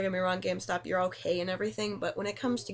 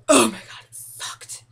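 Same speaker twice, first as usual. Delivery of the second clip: loud exclamation; 2 semitones higher, 11 dB louder, slower than usual. Real, not acted. Recalling being sick.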